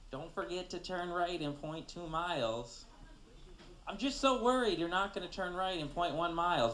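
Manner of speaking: guilt-trip them like a worried grandmother. Acted.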